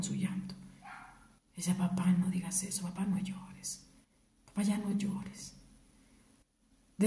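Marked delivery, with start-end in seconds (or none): 1.8-5.6 soft pleading. Retold or imitated. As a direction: softly beg him not to cry. Begging her dying father to stop crying.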